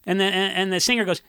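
usual speaking voice

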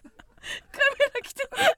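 slow, high, breaking with laughter; after laughing hard. Real, not acted. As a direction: gasp it out while laughing. Laughing fit retelling. (笑いすぎて震える声)

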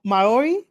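Maori with a plain unrolled R; unsure attempt at Maori, English R; own Maori pronunciation. Shown as a demonstration. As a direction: say Maori tentatively, R not rolled, rising as a question. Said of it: "I say, uh".

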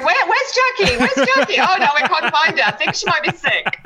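high, laughing impression of searchers; mock searching. Guest acts out classmates looking for her, laughing mock concern. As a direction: call around for a missing girl, half laughing.

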